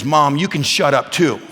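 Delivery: mild mock defiance toward mom; boy's retort to mom. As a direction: lightly voice a cheeky son. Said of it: "Bear looks at her and goes".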